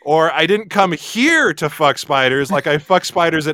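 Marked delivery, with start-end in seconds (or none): 0.0-2.5 slow, weight on "here"; stress on here. Shown as a demonstration. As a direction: dwell on "here" as if other places are fine. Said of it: "by the word you emphasize".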